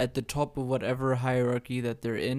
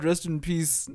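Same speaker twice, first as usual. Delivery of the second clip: ordinary talk, nothing marked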